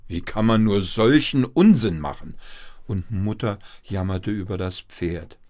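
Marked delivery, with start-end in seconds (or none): 0.1-2.4 loud, indignant telling-off; scolding father. A narrator's reading. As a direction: rebuke the child forcefully. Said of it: "schimpfte der Vater". (empört schimpfen)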